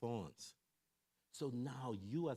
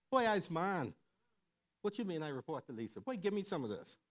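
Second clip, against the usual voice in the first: higher and louder, puffed-up boast; defiant bravado; 6 semitones higher, 4 dB louder than usual. Retold or imitated. Man rejecting his wife's control to his friends, loud defiant bravado. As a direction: prove you're nobody's man but your own.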